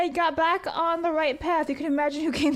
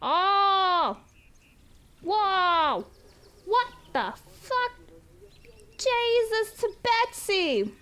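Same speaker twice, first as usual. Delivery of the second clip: slow, higher stunned exclaim; drawn-out amazement; slow amazed swear; 4 semitones higher, much slower than usual. Real, not acted. Reacting to a big donation.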